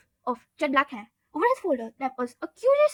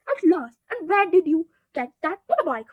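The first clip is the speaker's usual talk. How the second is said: relieved; 4 semitones higher, 6 dB louder than usual. A narrator's reading. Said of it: "sounding relieved".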